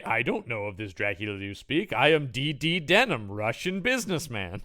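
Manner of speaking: hammed-up Russian-accented denial; mock Russian accent. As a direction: imitate a bad film Russian accent. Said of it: "trying to sound kind of Russian".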